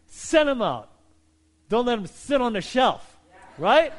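rally them with send; urgent call. Acted.

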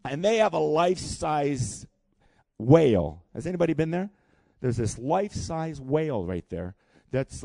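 ordinary delivery

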